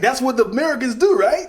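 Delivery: quick, high, loud American caricature; mock American accent. Foreigner explaining what Americans do, mock American accent. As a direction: rattle it off in an exaggerated American accent. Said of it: "can you talk American talking to Russian".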